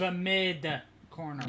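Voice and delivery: "The mid corner" said slowly, spelling out each word; slow and clear. Shown as a demonstration. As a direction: stretch out "mid corner" so they finally hear it. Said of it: "The Mig corner?".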